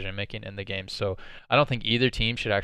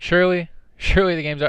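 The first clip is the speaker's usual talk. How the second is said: excited; 7 semitones higher, 5 dB louder than usual. Real, not acted. Incredulous laughing at game state.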